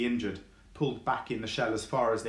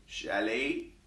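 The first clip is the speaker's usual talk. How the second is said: weak croaking tortoise. Acted. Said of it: "came its croak of a voice".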